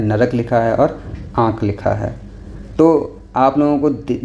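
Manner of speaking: normal talking voice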